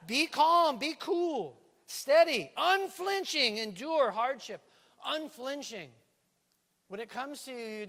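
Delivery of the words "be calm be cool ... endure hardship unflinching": put-on Jamaican accent. Preacher playing Jamaican accent again.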